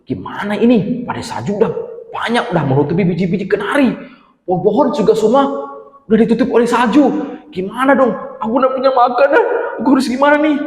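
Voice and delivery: high, anxious little animal fretting; panicky squirrel voice. Acted. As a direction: fret aloud like a worried little squirrel. Tutu panik kehabisan makanan. (suara tupai kecil yang panik)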